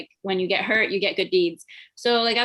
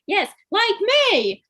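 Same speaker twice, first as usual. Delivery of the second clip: higher, louder, slow put-on British accent; fake British accent; 9 semitones higher, 4 dB louder, slower than usual. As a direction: keep up the hammy British accent for dad. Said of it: "kept doing the accent".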